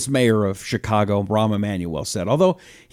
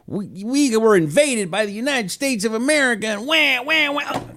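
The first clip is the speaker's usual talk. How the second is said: mocking whiny voice; 8 semitones higher than usual. Host mocks congresswoman's complaint.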